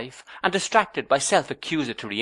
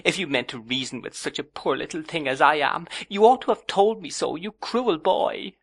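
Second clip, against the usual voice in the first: put-on reproachful young wife; 7 semitones higher than usual. A narrator's reading. Young wife reproaches her cruel boy of a husband, high and hurt. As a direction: accuse him, hurt and childish.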